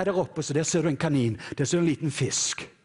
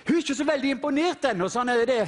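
high, driving preacher's voice; preaching at full force; 12 semitones higher than usual. Acted. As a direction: preach the eagle story with fervour. Preaches eagle story.

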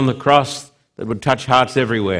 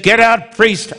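angry shout; 8 semitones higher than usual. Retold or imitated. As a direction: bark the priest away. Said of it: "the old dying atheist said".

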